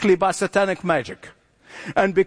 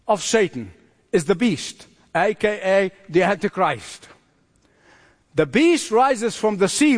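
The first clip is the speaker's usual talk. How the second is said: ordinary delivery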